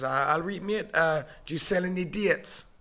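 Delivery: thick Sunderland accent, imitating a mate; strong Sunderland accent. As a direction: put on a broad Sunderland accent. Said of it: "he's got a strong accent".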